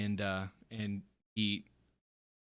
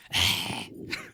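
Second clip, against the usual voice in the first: wordless voiceless rasp; short unvoiced creature noise; skeleton noise; 5 semitones lower than usual. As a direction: make the sound of skeletons in the back. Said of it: "The skeletons in the back go".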